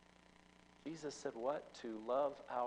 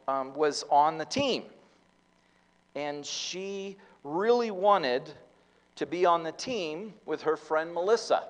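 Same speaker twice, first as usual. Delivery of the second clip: building sermon storytelling; 5 semitones higher, 13 dB louder than usual. Acted. Preacher tells the congregation about the tryouts, loud and emphatic. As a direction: drive the story home to the congregation.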